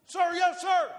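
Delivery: high, loud imitation of a recruit; recruit's shouted reply. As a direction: shout back to the sergeant. Recruit answering drill sergeant.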